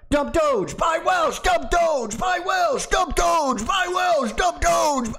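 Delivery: very high shouted chant; high shouting crowd chant; crowd screaming. Retold or imitated. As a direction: scream it like a crowd at each other. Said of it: "You could see them screaming that at each other".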